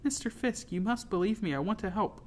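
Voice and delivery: high, earnest plea; pleading. A narrator's reading. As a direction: beg to be believed. Girl begs Fisk to believe her.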